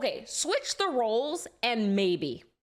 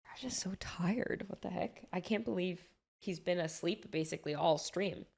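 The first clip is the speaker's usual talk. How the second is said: real whisper; 2 semitones lower, 7 dB quieter than usual. Real, not acted. Streamer whispers so the sleeper isn't woken, hushed. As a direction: whisper so you don't wake him. Someone asleep nearby on stream.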